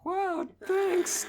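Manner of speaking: high excited voice; bright eager thanks. Acted. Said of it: "with an excited tone".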